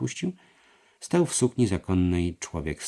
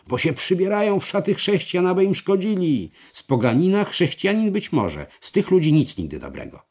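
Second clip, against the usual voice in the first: high, vehement tirade; yelling; 12 semitones higher, 7 dB louder than usual. A narrator's reading. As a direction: rant at the top of the voice, easing only at the end. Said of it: "zawrzeszczał Schwelborn". (gwałtowna, krzykliwa tyrada)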